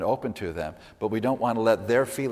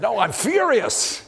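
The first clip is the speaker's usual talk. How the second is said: much higher and louder, slow furious correction; furious retort; 12 semitones higher, 6 dB louder, slower than usual. Retold or imitated. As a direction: snap back that it's far worse than angry. Retold person correcting angry guess.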